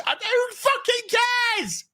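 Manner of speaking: high-pitched exasperated yell; drawn-out, fed-up holler; exasperated shout. Acted. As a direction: burst out in theatrical frustration. Rant breaks off the mock crying.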